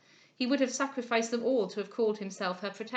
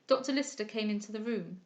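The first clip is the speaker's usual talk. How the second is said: usual speaking voice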